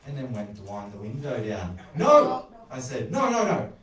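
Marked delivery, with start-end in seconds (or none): 1.9-2.5 sudden loud, high cry of refusal; urgent shout. A narrator's reading. Urgently stopping him discarding the canteen. 3.1-3.7 rapid string of loud, high protests; urgent shouted refusal. A narrator's reading. Urgently stopping him discarding the canteen.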